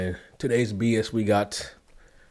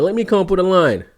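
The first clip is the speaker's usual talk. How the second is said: excited; 7 semitones higher, 5 dB louder than usual. Real, not acted. Repeats the joke.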